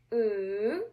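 "Mm" in a long swoosh, down then up; big third tone. Shown as a demonstration. Teacher drawing a big Nike with sound, long dipping hum. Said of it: "Make the sound go down".

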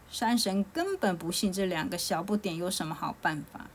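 usual speaking voice

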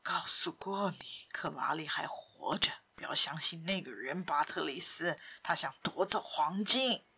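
put-on low, soft, half-whispered old-man voice; hushed warning. Simon warning Patrice about Lupin, hushed. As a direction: warn him in a low hush. Simon secretly warns his son.

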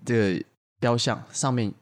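ordinary talk

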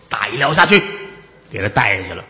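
ordinary talk

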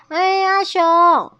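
high, loud, drawn-out call. A narrator's reading. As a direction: call slowly into the distance for a friend. 兔子也在呼喊阿熊. (拉长声音高声呼喊)

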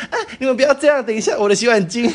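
high, laughing mock plea; fake protest. Girl mock-pleading with the fighters about her towel. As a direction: pretend to stop them while worried about the towel. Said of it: "我说".

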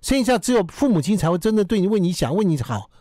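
high, nagging parent voice; nagging mom lecture. Retold or imitated. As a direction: scold like a worried mother. (唠叨妈妈训话)

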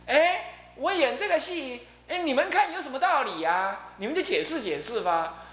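high, teasing challenge to the class; challenging taunt. Retold or imitated. Monk Huikong daring the class to explain his play, high teasing challenge. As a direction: toss out the puzzle like a dare. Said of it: "那个慧空就在又这么挑战".

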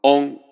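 オン sung out high as one held nasal syllable; オン, high and level, sound through the nose; syllable demo. Shown as a demonstration. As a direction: say オン as a clear, loud model syllable. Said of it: "三番目の音節文字の発音は".

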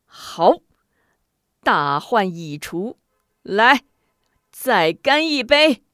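loud, exultant cry rising in pitch; triumphant toast. A narrator's reading. Official leaping up to toast victory, loud and triumphant. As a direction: ring it out, slowly and triumphantly. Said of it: "许仁拍案而起".

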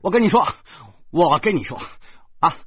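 high, loud, fast calling after someone; frantic shouting. A narrator's reading. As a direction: shout urgently to stop them. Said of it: "我急了，我说".

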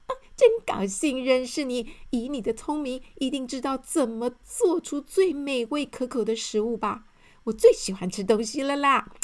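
loud, eager greeting; a greedy eater thrilled to meet a genius; happy. Acted. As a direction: gush with delight about food. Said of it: "高兴地回答说".

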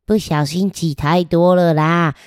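usual speaking voice

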